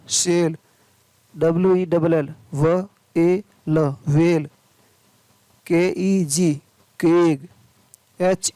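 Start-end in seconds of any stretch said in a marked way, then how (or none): 2.5-3.8 slow, separate phonics sounds; word broken slowly into its sounds; sounding out. Shown as a demonstration. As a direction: say each sound on its own. Phonics teacher sounding out spelling.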